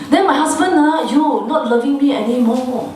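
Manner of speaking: high-pitched wounded spouse; hammed-up aggrieved lament; mock complaining. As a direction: whine as a neglected partner would. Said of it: "Then we go and complain".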